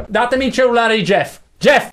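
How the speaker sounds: loud, high call across the room. Acted. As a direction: shout for Jeff to come. Calling out for Jeff.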